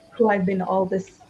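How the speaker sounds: ordinary talk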